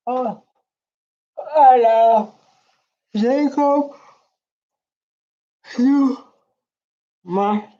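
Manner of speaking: high, dreamy declaration of love; gushing fan. Pretend. Pretending to declare love for Zuma, high and gushing. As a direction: swoon over "Jacob Zuma" like a besotted fan. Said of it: "I wanted to say, like".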